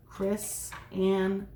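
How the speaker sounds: Chrysanthemum said slowly, syllable by syllable; slow modelled word. Shown as a demonstration. Teacher slowly models the name for children. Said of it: "Can you try saying it right now".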